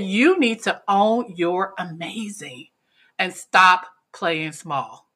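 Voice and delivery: slow, deliberate, every word weighted; emphatic advice. Retold or imitated. Attendee telling her the truth, slow and emphatic. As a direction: lay it down like a firm life coach.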